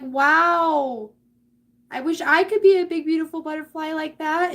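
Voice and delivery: loud, high, gushing wow; admiring onlookers. Acted. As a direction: gush with envious admiration, acted big. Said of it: "everybody looks at you and is like".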